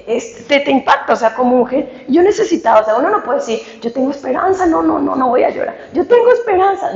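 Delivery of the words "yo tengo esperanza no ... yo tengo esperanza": high-pitched, insistent self-persuasion; mock brave denial. Woman mimicking someone who refuses to cry, mock brave denial.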